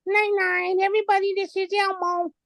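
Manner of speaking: very high squeaky puppy voice; way up high, childlike and bright; Elmo-style voice. Shown as a demonstration. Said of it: "he sounds up here".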